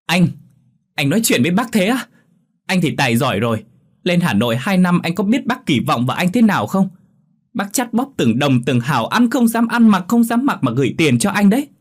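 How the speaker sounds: cousin scolds high and loud; snapping reproach. A narrator's reading. Niece to cousin defending her aunt, sharp loud reproach. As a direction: lash out at him. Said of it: "gắt lên".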